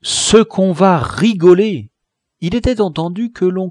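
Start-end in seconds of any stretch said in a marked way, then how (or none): 0.0-1.9 gleeful anticipation. Acted. Gleeful cry opening the feast.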